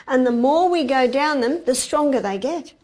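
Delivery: ordinary talk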